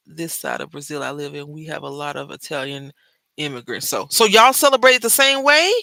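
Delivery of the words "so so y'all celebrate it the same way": surprised question. Retold or imitated. Quoting shocked question.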